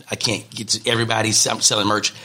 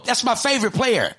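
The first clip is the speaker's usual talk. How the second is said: much higher, squealing with glee; excited high squeal; 13 semitones higher than usual. Retold or imitated. Friend squealing excitedly about meeting Deion Junior. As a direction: squeal like a starstruck fan. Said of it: "He said".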